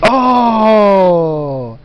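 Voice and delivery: long, falling coo of sympathy; adoring "oh". Real, not acted. Reacts to the baby's sneeze.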